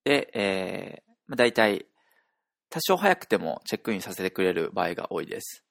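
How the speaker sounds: ordinary delivery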